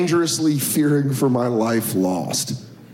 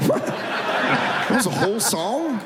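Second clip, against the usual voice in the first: baffled; 9 semitones higher, slower than usual. Retold or imitated. Baffled listener reaction.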